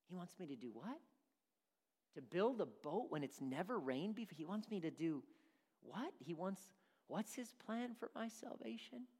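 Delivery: mild mock disbelief, hushed; incredulous believer. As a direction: balk at the odd command. Believer balking at God's command.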